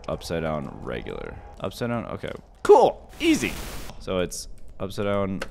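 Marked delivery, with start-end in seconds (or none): 2.6-3.5 high, loud delighted cheer; excited cheer. Real, not acted. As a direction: celebrate the win. Puzzle solved.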